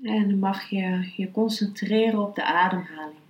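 ordinary delivery

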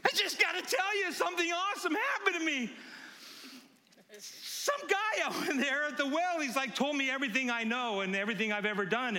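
high, breathless-to-tell excitement; giddy woman spreading her news. Acted. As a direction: burst out with amazing news, pitched high. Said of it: "giddy about all this".

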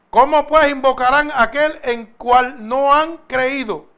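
slow, loud verse proclamation; emphatic scripture reading. Acted. Preacher reads verse fourteen to the congregation, slow and emphatic. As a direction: read it out slowly and forcefully, word by word.

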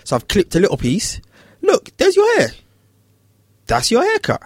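high, gentle sing-song coaxing; coaxing child. Retold or imitated. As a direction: barber coaxing the scared boy to touch the clippers. Reassuring child.

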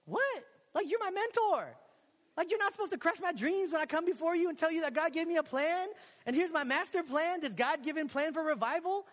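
high, loud, offended disbelief; hammed-up indignant squeal at a mentor; incredulous outrage. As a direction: protest like your dreams are being crushed. Retells outrage at mentor's verdict.

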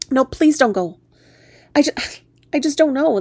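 urgent plea breaking into a sigh; pleading. Acted. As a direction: beg them to stay, then falter. Begging the frog to stay.

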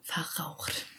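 raspy voice fading to a whisper; hoarse with a cold, trailing off. Real, not acted. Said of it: "meine Stimme ist nicht immer so verraucht".

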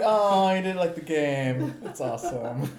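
giggling through the words; laughing. Retold or imitated. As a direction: laugh while you say it. Retold own reply, laughing.

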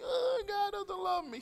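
high, slow whiny self-pity; mock whining. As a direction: whine it like a sulking believer. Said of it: "And then we bang our heads and we".

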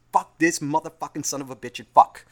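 re-enacted furious rant; acted angry rant. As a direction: act out a swearing fit at full volume. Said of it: "I get so fucking angry sometimes".

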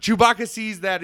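ordinary talk

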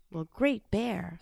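ordinary delivery

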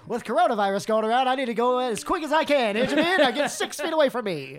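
high, loud, laughing barkeep; hammed-up bartender shooing customers; pushy bartender voice. Continuing bartender bit.

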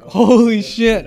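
reliving the moment of amazed excitement, loud and high; retold shock. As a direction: blurt it out slowly in stunned disbelief. Retold reaction to courtside bet offer.

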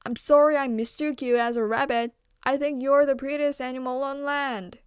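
loud call after someone leaving; the turtle shouting his apology to the deer walking away; shouted. Acted. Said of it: "he shouted to the direction".

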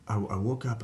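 usual speaking voice

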